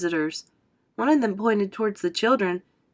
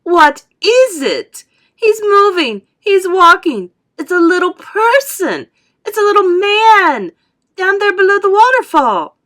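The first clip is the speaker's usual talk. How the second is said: very high, loud shriek; frantic high-pitched yell, stretched; excited scream; 11 semitones higher, 12 dB louder than usual. A narrator's reading. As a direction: scream it out to alert everyone. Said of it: "she screamed".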